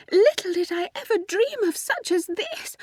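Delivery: put-on very high, swooning girlish voice; Ethel's rapturous voice. A narrator's reading. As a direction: speak blissfully, pitched very high. Ethel overjoyed before fainting.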